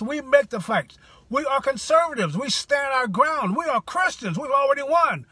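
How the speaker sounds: fired-up sermon to conservative listeners; rousing preaching. Acted. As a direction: preach it to the faithful crowd. Rallying sermon-like exhortation.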